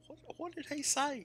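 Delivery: squeaky, baffled imitation; confused listener. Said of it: "people be like".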